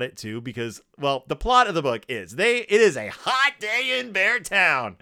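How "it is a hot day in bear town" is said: slow, put-on scene-setting voice; theatrical storyteller. Host opens the bears' story plot, theatrical. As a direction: announce it with hammy grandeur.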